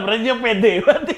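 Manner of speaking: laughing. Real, not acted. Teasing, laughing.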